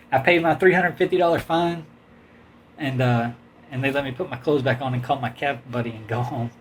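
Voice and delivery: usual speaking voice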